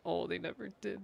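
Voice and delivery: ordinary talk, nothing marked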